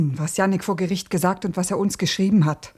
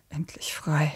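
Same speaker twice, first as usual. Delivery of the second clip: a hushed whisper, much softer; 6 semitones lower, 10 dB quieter, slower than usual. Acted. Dora whispers about Jannik facing death. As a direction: barely breathe the words.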